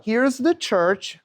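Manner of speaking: slow "here's the church" as a rhyme; nursery rhyme demo. Shown as a demonstration. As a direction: spell out "here's the church" slowly for the hand game. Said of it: "watch my hands as I do this".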